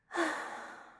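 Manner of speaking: airy, drawn-out sigh; wistful sigh. Acted. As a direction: let out a long breath. Krampus recalls his last meal.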